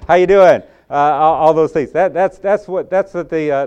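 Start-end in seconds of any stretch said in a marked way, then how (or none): 0.1-0.6 loud, high Southern howdy; Southern hello. Retold or imitated. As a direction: hail a buddy the Southern way, louder. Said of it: "That's the Southern way of saying".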